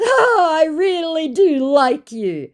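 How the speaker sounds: high, slow, drawn-out Oh; mock the scammer's oily charm; a sappy, hammed-up scammer crooning the line. Scammer's flirty reply read aloud.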